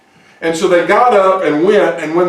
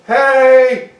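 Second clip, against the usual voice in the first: calling out; 7 semitones higher, 3 dB louder than usual. Acted. Lepers calling out at empty camp.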